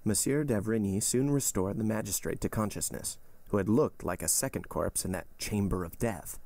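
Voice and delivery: ordinary delivery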